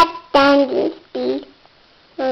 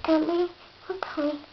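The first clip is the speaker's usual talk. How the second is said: fast urging; 4 semitones higher, 11 dB quieter, much faster than usual. Acted. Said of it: "called Edward".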